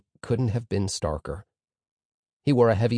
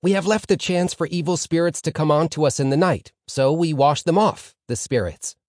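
eager pupil answering, higher and louder; keen student Reuven; 6 semitones higher, 4 dB louder than usual. A narrator's reading. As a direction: answer keenly as the star pupil.

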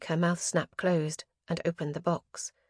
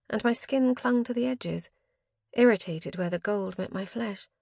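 plain reading voice

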